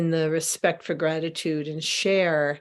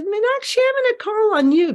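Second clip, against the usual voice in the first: excited; 5 dB louder, faster than usual. Real, not acted. Playfully calling on a participant.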